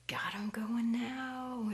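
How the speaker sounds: slow hushed whisper, holding back a laugh; gleeful whisper. Acted. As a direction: whisper it so the victims won't hear. Pranksters gloating under their breath.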